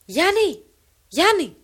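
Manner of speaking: loud, high calling out a name; a woman yelling for her neighbour's husband, wild and loud; wild strange cry. Acted. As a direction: call him across the valley at full voice. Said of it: "εφώναξεν με αγρίαν αλόκοτον φωνήν".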